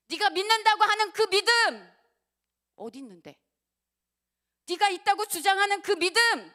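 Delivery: fiery preacher calling out the listener's faith; challenging preaching shout. Acted. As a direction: throw the challenge straight at them.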